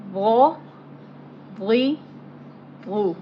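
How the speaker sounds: rounded heavy Arabic ra, ri, ru; soft thick tone. Shown as a demonstration. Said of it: "softly with the thick tone".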